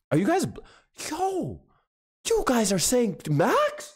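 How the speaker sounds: high, slow disbelief; incredulous. Real, not acted. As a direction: stretch it out, can't believe it. Disbelief at chat's vote.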